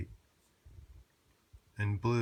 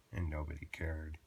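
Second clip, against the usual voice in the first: plain reading voice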